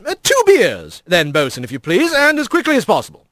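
genial captain giving orders; hearty, lilting skipper's request; jovial otter mayor. Acted. Big otter orders drinks.

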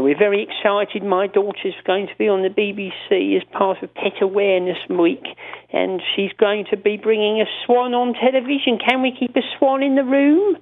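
high, prim, eager caller voice; Robin Cooper voice. Retold or imitated. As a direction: gush politely about the swan. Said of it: "he had a certain voice".

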